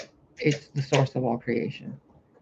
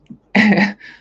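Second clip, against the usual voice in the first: burst of laughter; 6 semitones higher, 9 dB louder than usual. Real, not acted. Laughs after vision story.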